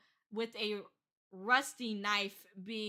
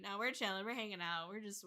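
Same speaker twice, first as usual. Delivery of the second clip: ordinary delivery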